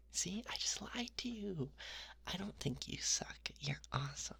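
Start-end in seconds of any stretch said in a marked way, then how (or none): none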